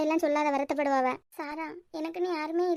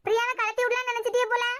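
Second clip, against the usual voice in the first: higher-pitched, exasperated rebuke; indignant scolding; 5 semitones higher than usual. Real, not acted. Wife scolding her husband for saying only okay, indignant and higher. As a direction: scold him for brushing you off. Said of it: "கத்தாத".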